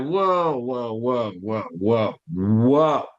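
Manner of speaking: low, drawn-out startled whoas; alarmed whoa. Retold or imitated. Reacting to his mentor's request, alarmed. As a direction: pull up hard in alarm.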